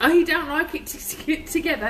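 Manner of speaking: ordinary talk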